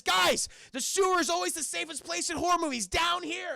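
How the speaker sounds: loud, high rallying shout; hammed-up urgent call. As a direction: shout to the group like a movie heroine. Meg calls the boys over.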